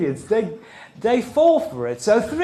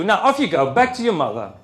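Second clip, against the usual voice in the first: ordinary delivery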